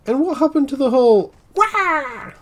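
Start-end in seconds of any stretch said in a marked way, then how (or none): 1.5-2.3 long, high imitated wail; Waluigi-like wah. Player imitates the pumpkin launcher's noise, Waluigi-like wah. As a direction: draw out a high cartoonish wah. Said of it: "that sounded a bit like Waluigi".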